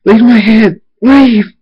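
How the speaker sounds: high, loud tormented screams; anguished shouted command; screaming. A narrator's reading. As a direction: scream to drive it out. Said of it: "Thunderstorm screams".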